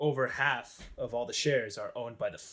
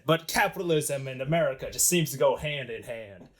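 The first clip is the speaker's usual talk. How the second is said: hammed-up American capitalist voice; American accent. Banker links capitalism with America, put-on American accent. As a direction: play a mock American money man. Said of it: "I have an American accent".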